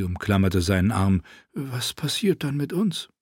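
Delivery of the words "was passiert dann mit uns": softer, higher, anxious question; scared small voice. A narrator's reading. Hela, frightened, asks Tyrion what becomes of them, quiet.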